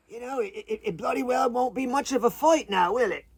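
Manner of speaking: put-on creature voice, giving up the fight; talking iguana. Iguana conceding defeat to the bigger visitor, high put-on voice.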